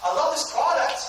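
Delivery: high-pitched, eager mock customer; keen caller. Customer calling to ask about the business, eager. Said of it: "call people and go".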